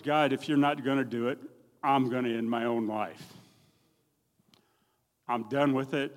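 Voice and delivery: loud anguished plea; desperate cry to God. Retold or imitated. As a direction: cry out to God that you're finished.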